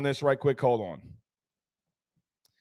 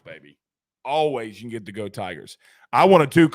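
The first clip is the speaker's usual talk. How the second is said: ordinary delivery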